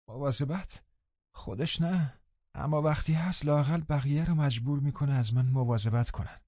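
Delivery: high, soft, moaning complaint; sighing and moaning. A narrator's reading. Linton moaning about his father, high and sighing. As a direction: groan it out self-pityingly. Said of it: "با آه و ناله گفت".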